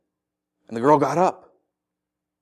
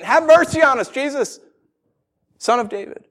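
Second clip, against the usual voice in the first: calling out; 10 semitones higher, 3 dB louder than usual. Acted. Blind men still following Jesus as he walks on, calling out. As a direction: cry out for mercy, then let it drop. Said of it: "they're shouting at him".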